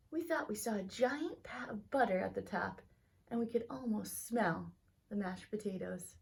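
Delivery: lively read-aloud storytelling voice; story narration. A narrator's reading. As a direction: tell it like a bedtime story to kids. Teacher reads picture book aloud.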